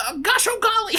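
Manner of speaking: very high, squeaky cartoon-mouse voice; Mickey Mouse voice. Imitating Tom Holland's American Spider-Man, Mickey Mouse voice. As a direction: pitch it way up like a wholesome cartoon mouse. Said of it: "He's, he's Mickey Mouse".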